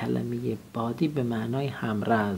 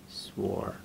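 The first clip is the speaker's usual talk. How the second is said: whisper; 4 semitones lower, 8 dB quieter than usual. Real, not acted. Whispering while writing.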